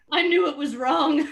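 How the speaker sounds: ordinary delivery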